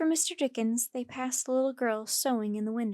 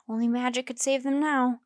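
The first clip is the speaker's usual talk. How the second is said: ordinary delivery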